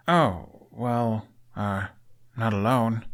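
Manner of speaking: halting, searching for words; hesitant answer. Acted. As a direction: stumble through the fillers before landing it. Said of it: "Hiccup finally articulated".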